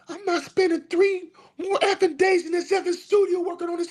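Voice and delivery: high mock diva voice, laughing. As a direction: squeal it like an irritated diva. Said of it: "she just says".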